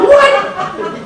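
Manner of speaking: very high, loud, laughing disbelief; incredulous what. Retold or imitated. Speaker on phone to wife at beach, incredulous shout.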